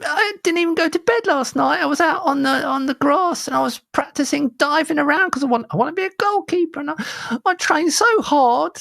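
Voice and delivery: put-on high, pleading little-boy voice; hammed-up earnest kid. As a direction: plead with Dad in a put-on child falsetto. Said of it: "it's my only kid voice I've got".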